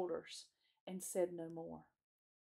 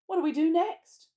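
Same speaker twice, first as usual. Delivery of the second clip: louder, higher question with a touch of eagerness; eager question; 10 semitones higher, 12 dB louder than usual. A narrator's reading. Susan asks the others what to do next, eager. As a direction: ask keenly for the plan.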